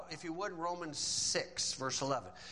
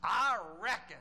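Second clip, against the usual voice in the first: thick southern accent; 3 semitones higher, 4 dB louder than usual. Shown as a demonstration. Preacher showing how to say reckon, thick southern accent. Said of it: "if you got a southern accent, really throw it on".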